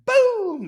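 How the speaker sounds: high, loud, drawn-out "boom"; explosive imitated bang; sudden loud boom. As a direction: burst out with the crash of the door. Imitates door being kicked in.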